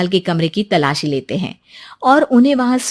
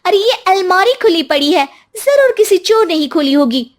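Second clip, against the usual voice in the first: high, loud accusing voice; accusing wife; 10 semitones higher, 4 dB louder than usual. Acted. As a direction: point the finger, high and loud. Wife accuses old man of theft.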